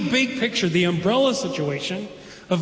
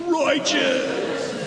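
high, drawn-out surfer cry; 7 semitones higher than usual. Shown as a demonstration. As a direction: shout it like a stoked surfer. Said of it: "practice it with me".

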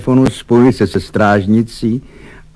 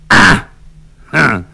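short high cry of discovery; exclaiming; 5 semitones higher, 4 dB louder than usual. A narrator's reading. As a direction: burst out with two sharp exclamations. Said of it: "vykřikl generál Fink".

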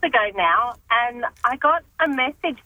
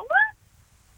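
shocked what. Retold or imitated. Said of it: "And I thought".

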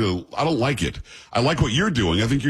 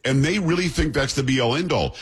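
usual speaking voice

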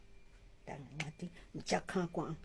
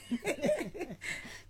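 laugh; 8 semitones higher than usual. Real, not acted. Laughs during the exchange.

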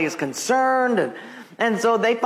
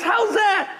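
a much higher, incredulous how's that; surprised; 13 semitones higher than usual. Acted. Pastor asking the sassy old lady, surprised and high. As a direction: pastor floored, begging the old lady to explain.

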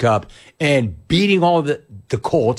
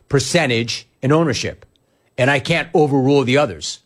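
normal talking voice

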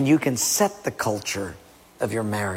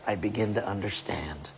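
whispered; 7 semitones lower, 11 dB quieter than usual. Acted. Sermon point dropped to hush.

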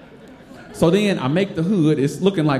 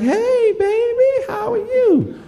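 very high sing-song auntie voice; high, sweet auntie hello; aunties greeting; slower than usual. Retold or imitated. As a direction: coo like the church aunties. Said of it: "they're like".

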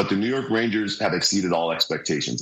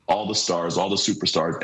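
normal talking voice

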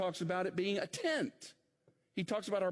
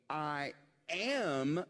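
"am" set apart and drawn out; stress on AM. Acted. Preacher quoting Jesus's present tense, stress on "am". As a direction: land weightily on "am" after a short "I". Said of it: "present tense".

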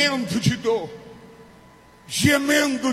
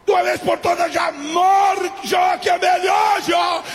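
preacher's acted outburst, building higher; desperate shouted reproach; 7 semitones higher than usual. Job's wife urging him to curse God and die, desperate shouting. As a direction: cry out in mounting despair.

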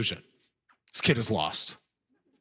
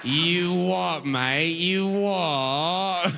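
hammed-up, drawn-out cockney, higher; mock British accent; 4 semitones higher, much slower than usual. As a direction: gleefully parrot British sounds, stretching the vowels. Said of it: "They're just making sounds you like".

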